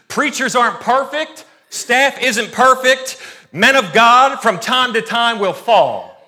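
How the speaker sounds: building preacher's cadence; preaching. Acted. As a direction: drive the point home to the church. Preaching emphatic point.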